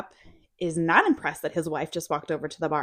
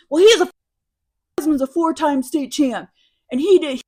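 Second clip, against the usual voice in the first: put-on loud, higher drunken brag; drunk wife bragging; 8 semitones higher, 10 dB louder than usual. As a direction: brag loudly, a bit sloshed. Drunk wife bragging about husband.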